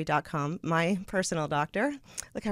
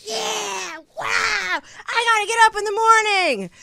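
high, loud excited shout; 12 semitones higher, 7 dB louder, slower than usual. Retold or imitated. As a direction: yell it like you're wide awake. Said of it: "I actually was like".

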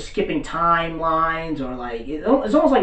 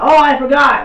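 high, loud mock cry of dismay; sudden realization; 11 semitones higher, 9 dB louder than usual. Assistant forgot to buy tea, alarmed. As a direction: blurt it high, imitating her.

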